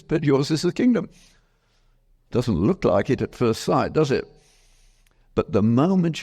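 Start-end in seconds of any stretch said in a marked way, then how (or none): 0.0-1.1 uplifted promise. Retold or imitated. Jesus' promise, raised voice.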